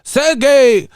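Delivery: high mock-Slavic gibberish voice; speaking in tongues. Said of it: "Y'all motherfuckers chanting".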